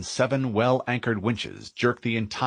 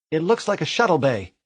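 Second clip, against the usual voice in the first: female AI voice; 7 semitones higher than usual. Acted. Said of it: "Cortana commented".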